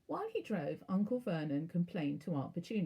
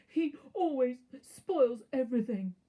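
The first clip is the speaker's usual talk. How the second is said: louder, higher spoilt whine; whiny yell; 6 semitones higher, 7 dB louder than usual. A narrator's reading. Spoilt son complaining to mother, loud whine. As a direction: bawl it like a spoilt brat. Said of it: "yelled between huge pretend sobs".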